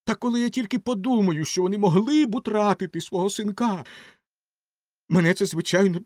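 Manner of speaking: high, emphatic distressed rocket; hammed-up high anguish; self-dramatizing Rocket.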